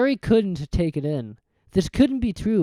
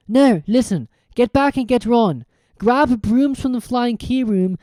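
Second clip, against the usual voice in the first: put-on louder, quicker boy giving orders; urgent Harry; 6 semitones higher, 5 dB louder than usual. A narrator's reading. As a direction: rush the plan out firmly.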